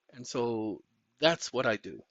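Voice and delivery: usual speaking voice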